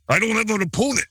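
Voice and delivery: put-on baffled wrestler voice; hammed-up wrestler lament, slowing at the end; Macho Man. Co-host imitating a wrestler with no opponent, put-on wrestler voice. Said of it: "And Macho Man's like".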